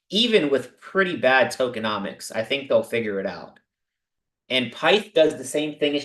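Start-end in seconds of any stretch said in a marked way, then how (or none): none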